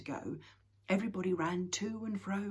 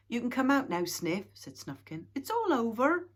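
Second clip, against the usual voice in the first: calling out; 7 semitones higher, 10 dB louder than usual. Acted. Snufkin calling to hiding Sniff.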